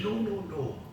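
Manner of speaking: high-pitched mock reproof; old actor's correction. Old actor correcting a novice's diction, high-pitched reproof. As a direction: imitate an old Scottish actor putting someone right. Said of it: "And Jimmy says".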